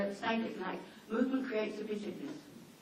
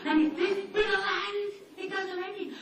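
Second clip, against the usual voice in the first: higher, louder, mocking; exasperated; 10 semitones higher, 5 dB louder than usual. Real, not acted. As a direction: roll your eyes at her fuss. Mocking the neighbour's obsession.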